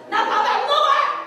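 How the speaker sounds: high, drawn-out angry yell; furious tantrum. Acted. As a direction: act out an enraged child refusing dinner. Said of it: "화가 머리 끝까지 나가지고". (화가 머리끝까지 난 아이처럼 높게 소리치기)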